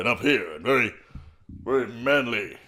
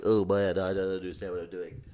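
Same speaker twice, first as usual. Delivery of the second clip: nerd voice; 6 dB quieter than usual. Shown as a demonstration. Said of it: "nerd voice, just classic".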